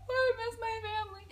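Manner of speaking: high, tearful squeak; hammed-up homesick sob; mock crying. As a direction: blubber it in a high voice. Acted weeping at the song.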